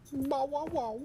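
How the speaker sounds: high yappy barks; dog barking. Pretend. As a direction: yap like a small dog. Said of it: "barking imitation".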